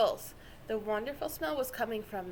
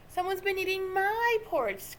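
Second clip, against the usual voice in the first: higher, dismayed cry; crying Mama Bear; 7 semitones higher than usual. A narrator's reading. Mama Bear finds her porridge tasted, crying out. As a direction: call out in dismay. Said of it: "cried Mama Bear".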